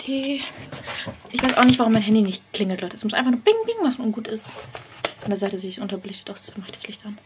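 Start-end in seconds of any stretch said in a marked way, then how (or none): 4.1-7.2 quick whispered aside. Real, not acted. Vlogger whispering while fixing the lighting. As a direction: whisper fast to yourself.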